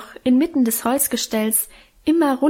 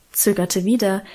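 usual speaking voice

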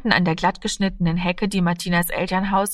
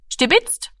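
sudden high blurt of "Stibitzt!"; 10 semitones higher than usual. A narrator's reading. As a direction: slip it out before thinking. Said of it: "Plötzlich entschlüpfte ihm". (plötzlich herausgeplatzt, hoch)

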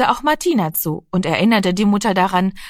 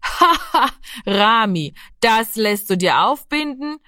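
mocking laugh; 3 semitones higher than usual. A narrator's reading. Big brother mocks boy's belief.

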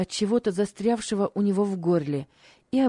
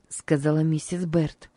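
usual speaking voice

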